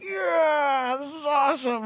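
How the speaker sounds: high yelled whoop; retold scream. [name] to the cheering crowd, high excited whoop. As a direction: yell it out like a big win. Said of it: "I just scream at the top of my lungs".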